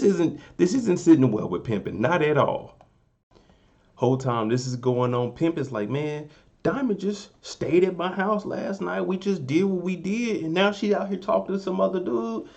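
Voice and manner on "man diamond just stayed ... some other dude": higher-pitched mock indignation; imitated jealous complaint. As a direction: complain like a wronged boyfriend, pitched up. Voicing jealous Pimpin's complaint.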